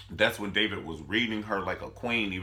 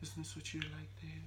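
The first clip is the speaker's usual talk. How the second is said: eerie high hush; crazy scary voice; 7 semitones higher, 15 dB quieter, faster than usual. Retold or imitated. Wife imitating the mistress for husband, crazy scary voice. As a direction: barely voice it, sweetly deranged. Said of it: "scary ass voice".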